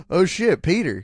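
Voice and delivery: loud, high excited shout; loud excited greeting. Pretend. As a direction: yell it like a hyped bro. Mock Aunt May reunion.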